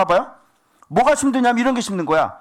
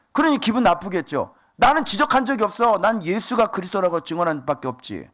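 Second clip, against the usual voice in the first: usual speaking voice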